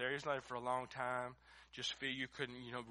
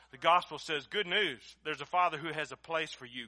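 fiery preaching; 3 semitones higher, 9 dB louder than usual. Acted. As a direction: preach it at full force, pleading. Preaching at full force.

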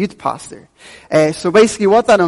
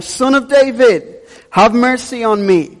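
high, slow, raised plea of "Son of David"; crying out louder; 7 semitones higher, 3 dB louder, slower than usual. A narrator's reading. Blind beggar calling out to Jesus over the crowd, raised and slow. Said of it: "he cried out all the more".

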